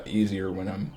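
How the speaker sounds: ordinary delivery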